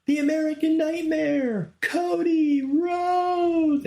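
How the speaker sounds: very high, loud, slow drawn-out champion announcement; imitating a ring announcer's booming winner call, drawn out. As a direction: announce the new champion to the whole stadium. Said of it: "she's like".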